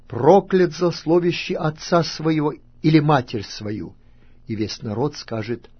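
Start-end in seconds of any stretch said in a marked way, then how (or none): 0.1-4.0 raised, higher solemn pronouncement; loud proclamation. A narrator's reading. Levites cursing whoever reviles father or mother, loud proclamation. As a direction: proclaim it loudly as a curse. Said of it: "скажут всем израильтянам громким голосом".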